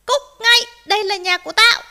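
high, very loud fox yell; angry shouting. A narrator's reading. Fox turns rabbit out of the house, shouting. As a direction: bellow it to drive someone off. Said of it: "nó quát thỏ".

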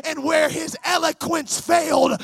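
loud, emphatic pulpit delivery; full-force preaching. Real, not acted. Preacher urging the congregation to commit, full-force preaching. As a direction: preach it out hard, punching key words.

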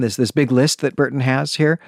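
normal talking voice

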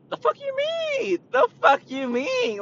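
high squealing retold outrage; mock outraged squeal. Retelling the rude customer, high squealing outrage. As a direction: shriek it back at the customer in disbelief.

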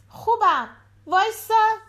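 put-on high, eager child's voice; little girl voice. A narrator's reading. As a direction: play the small daughter at the door. Daughter voiced.

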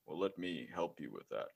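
a hint of a low soft insurance announcer; Allstate guy. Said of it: "sounds like the guy from Allstate".

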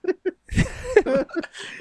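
high squealing laugh. Real, not acted. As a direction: let out a high delighted laugh. Laughing at own pun.

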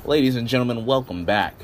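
booming ringmaster introduction. Acted. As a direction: announce him like a circus ringmaster. Ringmaster-style show intro.

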